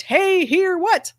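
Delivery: high, loud call to a dog; sing-song hey to a pet. Retold or imitated. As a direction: call your dog over, bright and eager. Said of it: "certain sounds I make for my German Shepherd".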